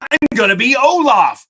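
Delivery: high mock announcement; high, sing-song mockery of an unwanted visitor; mimicking someone barging in; mocking a trespasser. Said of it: "and go".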